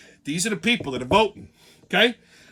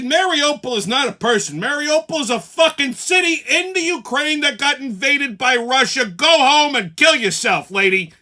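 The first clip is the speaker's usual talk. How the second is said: angry yell; 5 semitones higher, 7 dB louder than usual. Retold or imitated. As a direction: blast her ignorance. Telling the woman off.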